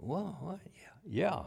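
hushed puzzled whisper; a bewildered whispered aside. Retold or imitated. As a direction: whisper it, confused. Listener's confused reaction.

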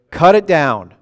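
loud, emphatic mock command; harsh verdict. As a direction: deliver the owner's order with force. Acting the owner's harsh verdict.

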